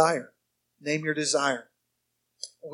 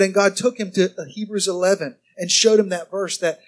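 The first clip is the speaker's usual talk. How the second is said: usual speaking voice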